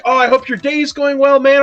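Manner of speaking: mock super-nice small talk; super polite. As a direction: wish them well with hammed-up courtesy. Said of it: "super polite".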